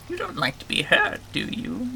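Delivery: high creature voice, dropping at the end; game creature voice. Acted. Game creature sizing up a visitor who may get hurt, high creature voice. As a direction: put on a high little creature voice. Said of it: "doing that voice".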